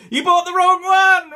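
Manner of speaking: loud, high gleeful shout. Real, not acted. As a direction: yell it out, triumphant. Teasing buyers of rival toy.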